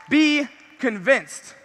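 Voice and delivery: very slow, weighty; slow emphatic. Real, not acted. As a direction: drag out be convinced. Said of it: "You guys wanna know a key?".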